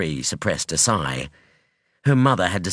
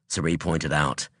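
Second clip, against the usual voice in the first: plain reading voice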